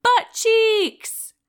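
sudden loud high yell; shouted "Butt cheeks!" from the front seat; yelled silly word. Retold or imitated. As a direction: blurt it out at full volume to stop the fight. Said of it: "I just yelled out the word".

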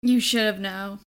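ordinary talk, nothing marked

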